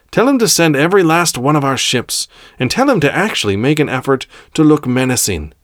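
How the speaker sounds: high, forceful orders; commanding Grand Master. Acted. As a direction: issue orders to the council.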